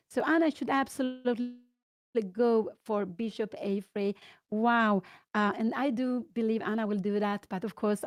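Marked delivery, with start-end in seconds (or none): none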